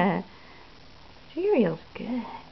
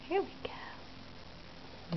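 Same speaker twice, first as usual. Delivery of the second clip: whispering; 6 semitones higher, 17 dB quieter, much slower than usual. Real, not acted. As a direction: breathe it out in a whisper. Quiet moment feeding the baby.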